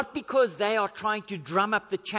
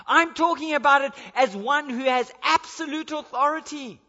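louder, higher, emphatic sermon delivery; preaching at full force; 8 semitones higher, 9 dB louder than usual. Acted. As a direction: drive home the authority of Christ. Preaching Jesus' authority with rising force.

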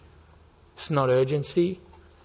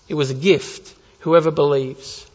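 usual speaking voice

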